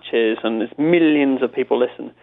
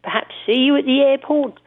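high, prim, overly polite phone voice; Robin Cooper voice; 9 semitones higher than usual. Retold or imitated. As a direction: play a fussy, genteel caller asking to meet. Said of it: "he sort of speaks a bit like this".

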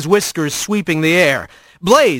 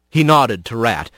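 usual speaking voice

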